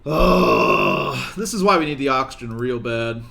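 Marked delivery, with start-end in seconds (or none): 0.1-1.4 deep, loud disgusted groan; frustrated groan. Real, not acted. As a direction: let out a low, heavy groan of frustration. Frustrated at colonist.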